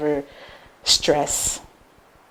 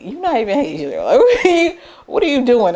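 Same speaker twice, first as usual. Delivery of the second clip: high mocking fatherly disbelief with a laugh; disapproving father; 6 semitones higher than usual. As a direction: tease her like a baffled dad. Father disapproving of her outfit.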